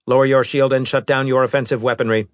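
fast, cold robot voice; a hint of a machine envoy; lightly voiced robot.

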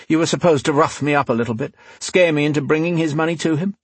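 unruffled rogue probing; cool rogue, mocking interrogation. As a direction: press the captive with dry mockery.

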